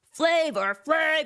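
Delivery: emphatic name call. Acted. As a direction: hit "Flavor" hard, slow and high.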